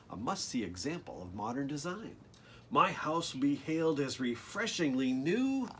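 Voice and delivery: lively, sing-song storybook read; bouncy picture-book reading voice; reading to children. Acted. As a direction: bring the rhymes alive for the kids. Reads the rhymed book text.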